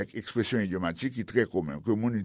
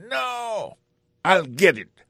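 high angry No, louder on I'll get it; very mad; 8 semitones higher, 3 dB louder, slower than usual. Acted. Pete refusing to lend phone, angry. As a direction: bark it back furious. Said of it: "answered very mad".

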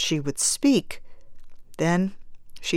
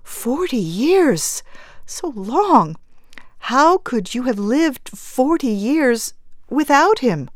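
higher, aching astonishment; anguished disbelief; 7 semitones higher than usual. A narrator's reading. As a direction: marvel in pain, drawing out the first words. Bereaved mother stunned by long grief.

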